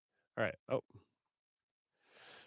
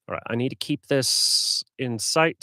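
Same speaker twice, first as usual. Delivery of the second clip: ordinary talk, nothing marked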